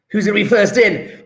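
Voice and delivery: ordinary talk, nothing marked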